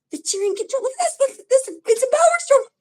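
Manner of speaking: crying out in amazement at the spread; high, loud excited exclamation over the cards. Real, not acted.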